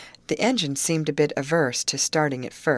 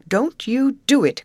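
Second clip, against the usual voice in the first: higher and louder, urgent warning; alarmed cry; 9 semitones higher, 8 dB louder than usual. Acted. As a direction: cry out to stop her friend. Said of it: "cried Grace".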